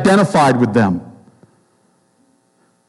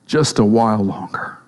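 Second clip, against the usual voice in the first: soft, lower, unhurried promise; gentle reassurance; 8 semitones lower, 3 dB quieter than usual. Acted. Preacher answering the suffering martyrs, soft and tender. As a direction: lower and softer, comfort them.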